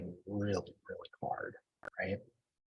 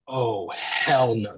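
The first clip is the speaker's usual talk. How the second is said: slow, emphatic mock shout; imitated indignant holler; wife's fierce refusal; 4 dB louder, much slower than usual. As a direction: draw out each word in outraged refusal. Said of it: "she got a lot of masculine energy".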